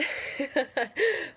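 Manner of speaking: short scoffing laugh. Real, not acted. Coach laughing at her ex's hoovering email, scoffing laugh. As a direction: laugh it off in disbelief.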